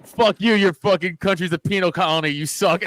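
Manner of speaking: loud, high retold outburst; imitated angry yelling, played for laughs; mock drunken tirade. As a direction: hurl the insult like a belligerent drunk. Drunk cousin insulting the women.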